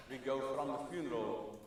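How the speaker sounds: ordinary talk, nothing marked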